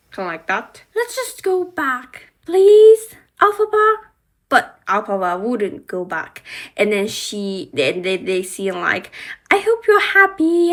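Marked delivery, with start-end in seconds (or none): 0.9-4.0 high, loud begging; pleading call. Pretend. Glinda begs Alphaba to return. 9.5-10.7 high, loud bitter jab; hammed-up angry parting shot; mock angry retort. Angry parting line in story.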